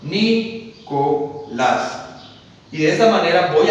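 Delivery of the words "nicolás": "Nicolás" said slowly as ni-co-lás; very slow syllables. Shown as a demonstration. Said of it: "La divido en sílabas". ("Nicolás" muy lento, sílaba por sílaba: ni-co-lás)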